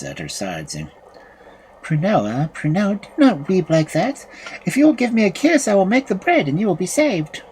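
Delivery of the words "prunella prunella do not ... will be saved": comforting youth. A narrator's reading. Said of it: "hearing Benezabel's voice".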